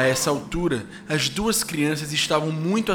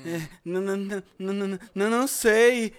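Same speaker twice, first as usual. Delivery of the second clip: scared stammer; 6 semitones higher than usual. Acted. Scared child stammering to stranger.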